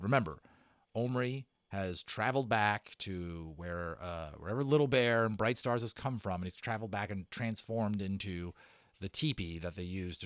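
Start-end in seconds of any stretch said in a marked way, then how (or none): none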